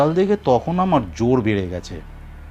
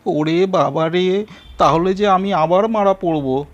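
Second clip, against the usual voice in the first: wailing; 7 semitones higher than usual. A narrator's reading. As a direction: cry out in fright. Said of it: "ভ্যাঁ করে কেঁদে বলল".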